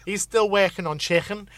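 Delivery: lightly Scouse, with a lifted tune; a touch of Liverpool accent. Shown as a demonstration. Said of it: "bringing out my Liverpool now".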